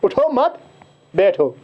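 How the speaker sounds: a clear stop between मत and बैठो; pause after मत. Shown as a demonstration. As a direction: lift उठो मत as one phrase, then बैठो alone. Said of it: "फिर कहाँ से दिया है".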